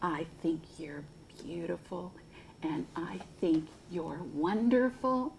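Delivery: tender whisper. Acted. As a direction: whisper it gently to a child. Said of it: "and he'd say".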